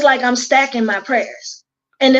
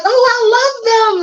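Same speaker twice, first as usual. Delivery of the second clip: excited gush; 10 semitones higher than usual. Retold or imitated. Excited quote of gifts.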